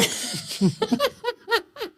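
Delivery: high-pitched laugh after his own joke; high, cackling real laughter. Real, not acted. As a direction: crack up at your own impression.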